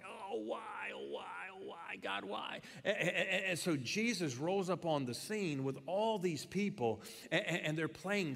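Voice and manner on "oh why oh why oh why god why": high, soft mock wail of a paid mourner; mock wailer. Preacher imitating a paid mourner, mock high soft wail. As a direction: ham up a hired wailer's sobbing cry. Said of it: "just cry and sob and say".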